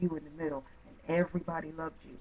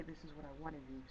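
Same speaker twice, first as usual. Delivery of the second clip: put-on soft, dropped voice of the old habit; half-voiced tentative wish; quiet demo line; 2 semitones lower, 20 dB quieter, faster than usual. Shown as a demonstration. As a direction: murmur it like a weak wish. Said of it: "no longer say".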